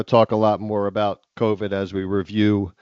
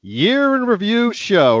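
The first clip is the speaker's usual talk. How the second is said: announcer intro; 4 semitones higher, slower than usual. Acted. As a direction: open the show like a game-show announcer. Hosts open the show.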